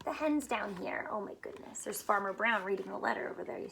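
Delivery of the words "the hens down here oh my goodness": ordinary delivery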